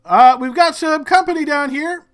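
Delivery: high, loud warning call; guard alerting his men to company. Acted. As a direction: warn the others down the line. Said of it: "the one in the back says".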